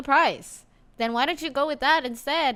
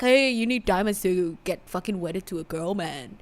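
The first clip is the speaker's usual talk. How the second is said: lightly put-on tough guy voice; a hint of a mob boss; mafia voice; 6 semitones lower, 3 dB quieter than usual. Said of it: "Is that your dude voice?".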